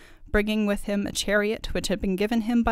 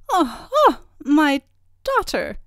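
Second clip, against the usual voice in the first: high, loud fairy voice; fairy godmother, dismayed; 7 semitones higher, 6 dB louder, slower than usual. A narrator's reading.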